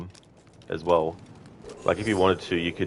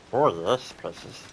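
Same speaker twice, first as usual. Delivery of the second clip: light Andy Serkis Gollum; 3 semitones higher, slower than usual. Shown as a demonstration. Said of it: "go into Andy Serkis's version of it".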